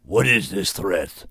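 mild annoyed beast voice. A narrator's reading. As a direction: demand it impatiently. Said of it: "It raised its voice in annoyance".

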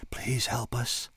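slow, drawn-out pleading whisper; begging weakly from the dark. A narrator's reading. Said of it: "they whispered".

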